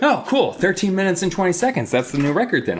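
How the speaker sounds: high, breezy mock enthusiasm; pretend carefree delight. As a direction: act out a chirpy, unbothered reaction. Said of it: "He's like".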